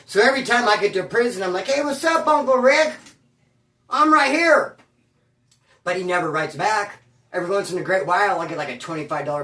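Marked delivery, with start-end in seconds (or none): 1.7-4.8 higher, louder bright greeting; cheery hello. Retold or imitated. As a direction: wave hello eagerly. Cheerfully greeting uncle by letter.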